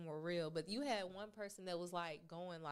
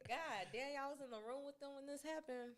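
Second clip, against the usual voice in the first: sarcastic mock quote; 6 semitones higher than usual.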